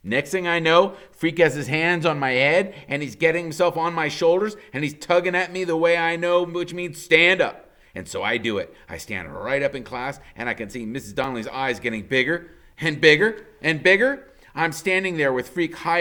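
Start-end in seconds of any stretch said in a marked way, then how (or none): none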